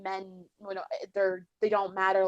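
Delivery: ordinary talk, nothing marked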